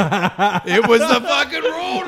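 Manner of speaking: slow, high laughing yell of disbelief; laughing shout. Retold or imitated. Telling friends the road was the same one, laughing shout. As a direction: howl it out laughing.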